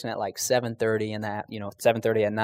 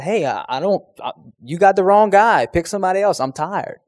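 higher, louder whiny protest to God; pleading complaint; 6 semitones higher, 6 dB louder than usual. Retold or imitated. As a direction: beg to be let off, tired and put out. Debating with Jesus, complaining.

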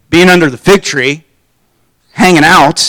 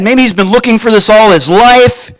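ordinary delivery

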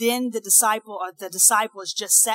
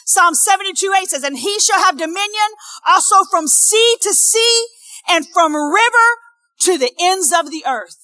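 loud, raised declaration of the verse; ringing pulpit reading; emphatic scripture preaching; 9 semitones higher, 9 dB louder than usual. Acted. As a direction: proclaim it to the whole congregation.

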